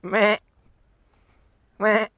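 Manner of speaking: short imitated goat bleats; goat bleat imitation. Rider answering the goat he spotted, imitated bleat.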